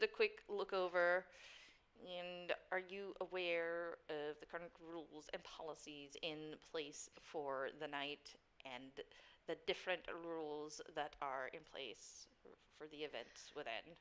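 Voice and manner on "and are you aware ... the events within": formal doorman. Acted. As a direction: recite house policy as a low-voiced doorman. Game master plays doorman.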